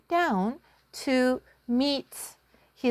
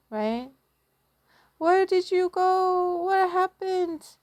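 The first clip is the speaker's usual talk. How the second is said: worried mother; 8 semitones higher than usual. Acted. Mother greeting her lost son, high and worried. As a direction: sound like a mother who feared the worst.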